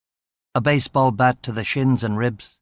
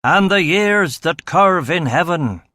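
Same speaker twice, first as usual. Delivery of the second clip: louder, rising fervent sermon; preaching; 3 semitones higher, 3 dB louder than usual. A narrator's reading. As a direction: preach it out to the congregation. Preacher heard in gospel hall.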